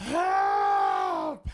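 high, loud, drawn-out shout; mock cry for help. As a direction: yell it in playful panic. Co-player echoes the acted plea.

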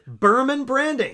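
slow, loud sponsor plug; ad read. Acted. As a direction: announce the brand name proudly.

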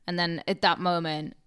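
normal talking voice

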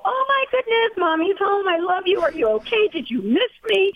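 very high-pitched, squealing hello to the dog; over-the-top baby-talk homecoming; overexcited pet-parent gushing. Acted. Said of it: "your voice goes up ten octaves".

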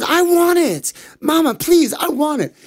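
put-on high pleading child voice; whiny little boy begging. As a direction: beg Mama like a greedy little kid. Ganesha begs mother for sweets.

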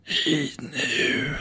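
raspy whispered smoker lady; smoker woman voice. Retold or imitated. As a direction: breathe it out like a chain-smoking waitress. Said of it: "You do a better smoker woman voice".

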